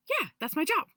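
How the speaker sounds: high, bright, eager declaration; proud excited helper. Retold or imitated. Little being proudly naming his job, high and excited. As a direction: announce it like the best job ever. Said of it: "super excited about, like, h-, uh, this is his job".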